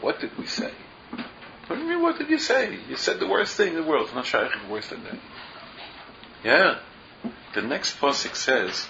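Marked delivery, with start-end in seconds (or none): 1.7-4.0 much higher, aghast disbelief; incredulous retort. Retold or imitated. Rabbi answering the sinner's question with incredulous disbelief. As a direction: throw it back at him in disbelief.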